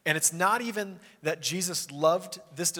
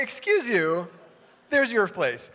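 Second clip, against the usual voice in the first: loud, higher-pitched "excuse you" put-down; indignant rebuff; 5 semitones higher, 8 dB louder, slower than usual. Acted. Host to a friend sitting in his lap, indignant. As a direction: send the intruder off, loud and affronted.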